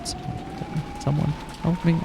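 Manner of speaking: desperate plea. Acted. Trapped girl begging for help.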